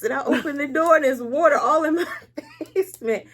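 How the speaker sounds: laugh-crying. Real, not acted. Tells of finding flood, laughing.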